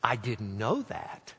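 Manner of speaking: soft, low, slow discovery; awed and unhurried; quiet realization. Shown as a demonstration. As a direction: say it as a hushed revelation. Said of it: "it becomes".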